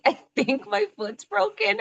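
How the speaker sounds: retelling texting her running partner, high and rueful; high, laughing disbelief. Retold or imitated. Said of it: "I'm like".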